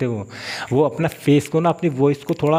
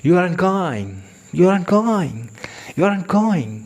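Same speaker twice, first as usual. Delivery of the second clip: mock English accent on "you aren't going", pressed higher voice; English speaker imitation; 5 semitones higher, much slower than usual. Teacher imitating how English people say it. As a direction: squeeze the voice in the throat like an Englishman. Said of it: "वो आवाज़ को दबा लेते हैं इधर".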